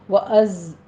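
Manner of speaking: slow, melodic tajweed chant; Quran recitation. Acted. Teacher recites the verse to her students, slow chant. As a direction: recite the verse slowly and tunefully.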